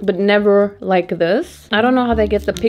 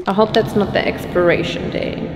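ordinary talk, nothing marked

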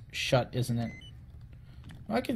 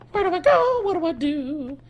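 comic whine; 13 semitones higher than usual. Pretend. Lost player asking what to do, comic whine. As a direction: pipe it up very high.

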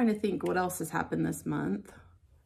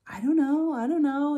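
higher, mock-whiny kid's "I don't know"; 7 semitones higher, 4 dB louder than usual. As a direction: imitate a clueless child shrugging it off. Child voiced in retold story.

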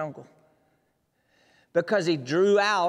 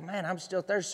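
mild sheep grumble; 8 dB quieter than usual. Acted. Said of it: "and he's like".

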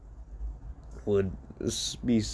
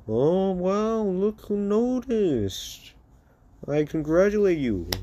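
high, drawn-out mock amazement; sarcastic congratulation; 8 semitones higher, slower than usual. Bullied son to the father who finally noticed, sarcastic. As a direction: gush with fake amazement.

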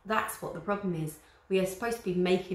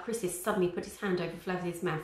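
plain reading voice

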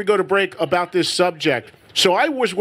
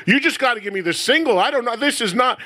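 lightly acted, higher-pitched indignant shopper; mock a housewife returning a record, pitched up and hurried; complaining customer; 6 semitones higher than usual. Housewives returning the album to the store clerk, mock indignant. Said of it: "on the counter and be like".

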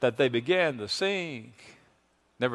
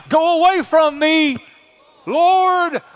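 loud, high, earnest retake, said like he means it; mean every word, much higher and louder; like you mean it; 12 semitones higher, 8 dB louder, slower than usual. Shown as a demonstration. Preacher urging the church to say it like they mean it, earnest loud cry. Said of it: "Come on, like you mean it now".

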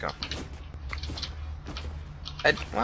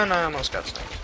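usual speaking voice

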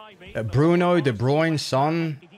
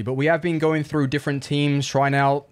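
ordinary delivery

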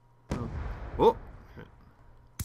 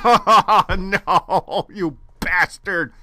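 laughing rage; 5 semitones higher, 8 dB louder, much slower than usual. Real, not acted.

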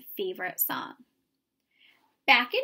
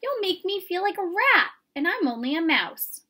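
higher, louder pleading mouse voice; distressed mouse; 7 semitones higher, 4 dB louder than usual. A narrator's reading. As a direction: beg your friend to stop.

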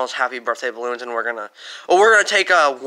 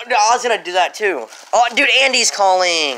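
helium voice; 6 semitones higher, 7 dB louder than usual. Real, not acted.

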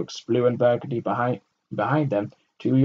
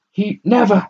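high, loud protest cut short; starting to protest; 11 semitones higher, 10 dB louder than usual. A narrator's reading. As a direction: try to break in. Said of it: "she started".

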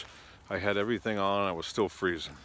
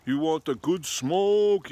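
slow, high, louder put-on hustler voice, drawn out; pot-selling airport guy; 9 semitones higher, 6 dB louder, much slower than usual. Airport worker offering weed, slow mock hustler voice. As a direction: lure him in like a sly street seller. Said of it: "He's like".

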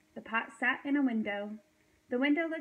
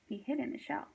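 ordinary delivery, nothing marked

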